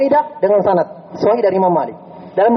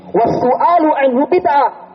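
slow emphatic recitation; 7 semitones higher, much slower than usual. Shown as a demonstration. Reciting Imam Malik's saying.

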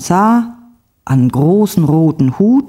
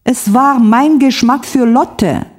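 a hammed-up weepy complaint; tearful wail; 7 semitones higher than usual. A narrator's reading. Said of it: "weinte Frau Stolze". (weinerlich klagend)

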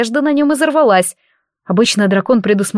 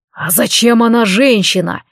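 ordinary delivery, nothing marked

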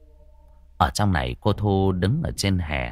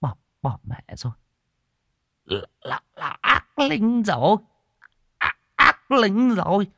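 terrified stammer; 11 semitones higher than usual. A narrator's reading. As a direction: panic breaking through a fake monk's nerve. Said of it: "vừa nhìn thì khiếp sợ". (giọng lắp bắp khiếp sợ)

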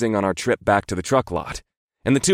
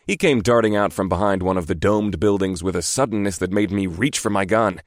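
ordinary delivery, nothing marked

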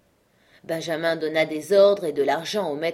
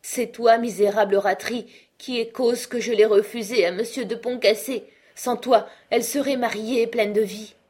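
put-on high, loud accusing old father; father blaming the uncle for her death; grief turned to rage; 5 semitones higher, 5 dB louder than usual. A narrator's reading. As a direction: blame him bitterly. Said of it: "sa douleur se changeant en colère".

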